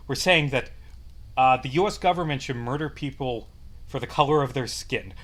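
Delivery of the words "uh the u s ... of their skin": ordinary delivery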